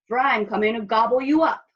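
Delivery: loud, quick troll threat; troll's roar. A narrator's reading. Troll threatening to eat the little goat, loud roar. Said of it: "roared the troll".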